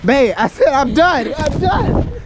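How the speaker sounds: high, loud cries of "I'm done"; loud pleading. Real, not acted. To her partner during the snowball fight, loud, high pleading. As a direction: beg your partner to stop, loud and rising.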